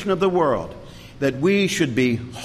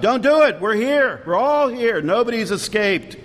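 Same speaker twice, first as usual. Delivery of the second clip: crying out; 7 semitones higher than usual. Retold or imitated. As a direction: shout to stop the jailer falling on his sword. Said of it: "And Paul cries out".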